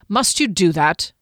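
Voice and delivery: a touch of grumpy irritation; mildly annoyed at being startled; lightly grumbling, understated. Acted. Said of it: "he said grumpily".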